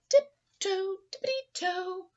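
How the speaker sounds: high, light tiptoe singsong; dainty singsong. Acted. Puppies learning to walk with grace, a high dainty singsong. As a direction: float it up daintily.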